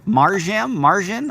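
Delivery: questioning MAR-zham, MAR-zhan for Marjam, Marjan; guessing pronunciations. Shown as a demonstration. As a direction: test Marjam, then Marjan as high questions. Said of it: "how to pronounce her name".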